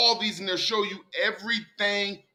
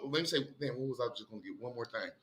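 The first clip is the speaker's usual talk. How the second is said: much softer, thinking aloud under his breath; softly asking himself what he meant to say; muttering to himself; 8 semitones lower, 14 dB quieter, faster than usual. Real, not acted. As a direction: drop away as if talking to yourself mid-search. Trailing off, searching for his point.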